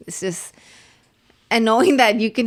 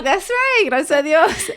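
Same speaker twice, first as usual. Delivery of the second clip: high, loud proud exclamation; proud cheer; 8 semitones higher, 7 dB louder than usual. Retold or imitated. Mom cheering her son's blessing, loud and proud.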